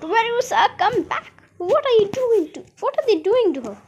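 alarmed calling. A narrator's reading. Parent calls to daughter attacked by squirrels, alarmed. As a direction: call the child back in acted alarm.

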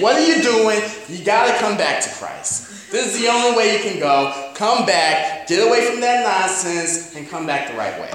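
high-pitched, urgent New York scolding; Paul in a New York accent. Acted. Preacher as Paul urging strayed believers home, New York accent. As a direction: call them back in a hammed-up New York accent. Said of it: "It's a weird accent, but he's basically saying".

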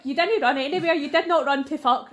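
exasperated, emphatic debunking; mock scolding. Real, not acted. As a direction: call out their tall tale, loudly. Mockingly debunking partner's heroic tale.

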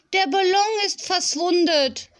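put-on high little-girl wail of disappointment; disappointed child's cry. A narrator's reading. Small girl has lost her balloon, calling out disappointed. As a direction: call out high, like a let-down toddler. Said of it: "rief Fee enttäuscht".

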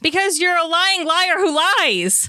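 drawn-out, high-pitched taunt; indignant, stretched-out retort; angry accusation. Acted. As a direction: hurl the accusation slowly and pointedly. Accusation thrown back in acted quarrel.

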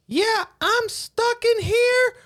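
put-on very high, slow child's wail; little kid. Kid stuck in the stall without paper, high child voice. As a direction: imitate a stranded kid in a squeaky drawn-out voice. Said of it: "this little kid says".

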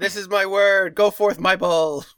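loud, slow, booming papal decree; pompous pope. Retold or imitated. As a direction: proclaim it like a grand pope. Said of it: "they'd be like".

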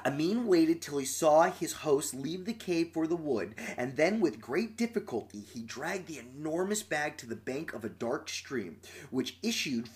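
quiet, even storytelling read; story narration. A narrator's reading. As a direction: read it like a bedtime tale. Reads story aloud.

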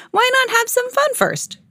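playful high voice. Acted. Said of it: "chuckled Bear".

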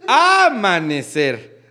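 amanecer with a drawn-out, stressed first A; AAA-manecer, slow demonstration. Shown as a demonstration. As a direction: exaggerate the first syllable of amanecer. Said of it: "ahí te van todas".

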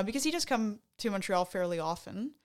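ordinary talk

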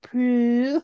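high-pitched, mimicked "Prue"; odd name pronunciation. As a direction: imitate the actress's strange, drawn-out "Prue". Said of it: "she came to Prue and she was like".

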